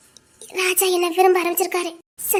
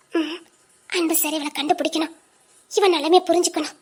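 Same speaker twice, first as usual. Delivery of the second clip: normal talking voice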